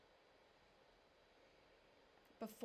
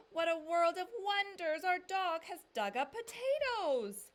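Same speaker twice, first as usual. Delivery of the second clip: much higher and louder, bursting with wonder; amazed exclamation; 10 semitones higher, 11 dB louder than usual. A narrator's reading. Papa shouting to the family about the potatoes, amazed. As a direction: cry it out like you can't believe it.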